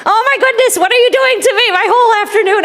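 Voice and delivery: high-pitched, overwhelmed protest; exasperated little bird. Acted. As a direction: complain in a high, put-upon bird voice. Bird protests impossible word.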